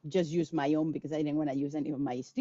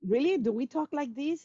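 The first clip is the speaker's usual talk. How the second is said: mock disbelief; 8 semitones higher than usual. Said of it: "It's like".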